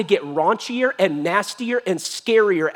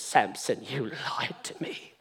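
low, very soft mock-hurt murmur; hushed reproach; 8 semitones lower, 11 dB quieter than usual. Delilah blaming Samson once more, hushed mock reproach. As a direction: drop to a near whisper, accusing.

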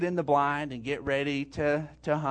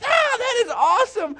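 high, loud delighted cry; excited shout; 9 dB louder than usual. Retold or imitated. Father reacting to his son's praise with an excited shout. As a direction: shout it with joy. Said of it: "And I was like".

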